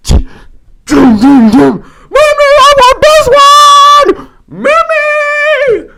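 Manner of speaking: put-on piercing high, loud kid whine; screaming child. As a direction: scream for mom like a demanding brat. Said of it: "It's like they're screaming".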